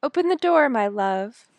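high, loud call to the door; crying out. A narrator's reading. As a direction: call out from bed, dropping on the endearment. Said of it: "cried out".

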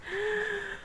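high, soft intake of breath; sharp gasp. A narrator's reading. Girl about to sing the first note, gasping.

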